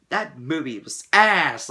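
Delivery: slow and loud, hammed-up disgust; mock loud verdict. Imagined overhyped fan slams the movie, loud and slow. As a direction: drag it out like a furious fan. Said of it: "to the point where I'm like".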